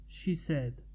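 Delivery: ordinary delivery, nothing marked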